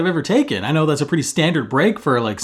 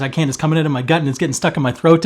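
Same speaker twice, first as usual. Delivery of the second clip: normal talking voice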